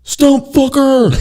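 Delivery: high, slow, drawn-out yell; stretched shouted warning across the yard; loggers yelling. Retold or imitated. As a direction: holler it out long and high like a warning. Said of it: "you hear these loggers go".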